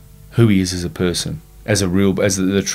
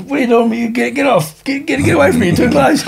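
mock-panicked yelp, much higher; startled squeal. To the bloke on his shoulder, a mock-panicked high yelp. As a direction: squeal it like he's crowding you.

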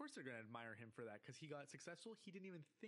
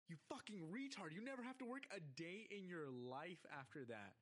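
raised pitch, ranting disbelief; exasperated outburst; 3 semitones higher than usual. Retold or imitated. Viewer berating a cartoon kid over lost millions, exasperated. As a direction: scold from high, easing down as it goes.

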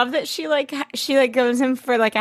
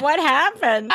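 higher, startled "What happened?"; mock shock; 7 semitones higher than usual. A viewer baffled by the voice change, asking in mock shock. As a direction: gasp out the question in disbelief. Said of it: "And I'm like".

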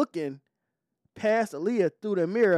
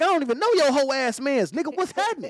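mock tough challenge; 10 semitones higher, 5 dB louder than usual. Retold challenge to the homie.

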